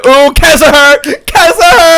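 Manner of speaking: hammed-up Latin accent, high and loud. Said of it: "know you doing a Latin accent".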